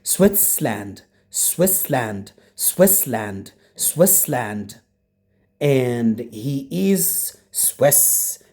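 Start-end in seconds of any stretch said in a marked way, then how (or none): 0.0-4.8 "Switzerland" squeezed to SWITS-lund, no "er"; correct pronunciation. Shown as a demonstration. Teacher corrects what some students pronounce wrong, "Switzerland" without the "er". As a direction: drop the middle vowel of "Switzerland", SWITS-land. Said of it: "Not Switzerland".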